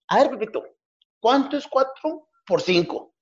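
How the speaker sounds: high, slow teacher voice quizzing Pepito; teacher quizzing a pupil, high and slow; mock teacher. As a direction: ask it slowly like a patient schoolteacher.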